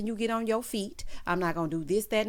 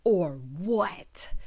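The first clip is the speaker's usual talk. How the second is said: breathy mock-menacing whisper; 3 semitones lower than usual. Whispering a mock threat to a rival. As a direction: hiss it like a dare.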